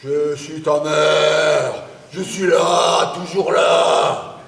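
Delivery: slow mock voice from beyond the grave; mocking ghost voice. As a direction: ham up the ghostly drone, slowly. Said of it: "cette voix d'outre-tombe".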